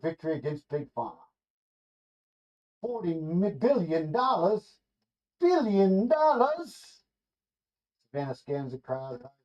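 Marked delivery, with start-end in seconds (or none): 2.8-7.0 slow, rising to a high, loud cry of disbelief; can't believe the number, drag it out; stunned disbelief. Acted. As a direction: billion stretched high and loud. Crowd reacts to huge verdict sum.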